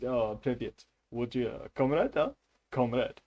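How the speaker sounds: chummy Russian comrade; put-on Russian accent, casual and questioning. Shown as a demonstration. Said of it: "like, Russian words and stuff it comes across".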